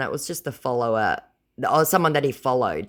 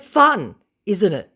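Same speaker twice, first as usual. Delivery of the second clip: high, loud sarcastic sing-song; 9 semitones higher, 8 dB louder, slower than usual. Retold or imitated. Sarcastically taunting the scammer, high and loud. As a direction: needle him sweetly.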